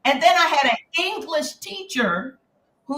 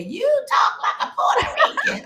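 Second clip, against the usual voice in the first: high-pitched mocking child; teasing kids; 12 semitones higher than usual. Kids teasing the new girl from New Jersey, high mocking. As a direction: imitate kids jeering, voice pitched way up. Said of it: "And the kids would say".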